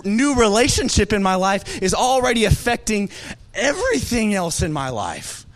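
ordinary delivery

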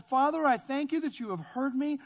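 loud, high projected praying; boisterous prayer. Acted. Jesus praying aloud before the bystanders, boisterous prayer. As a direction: pray loudly for the onlookers to hear. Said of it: "kind of a boisterous prayer".

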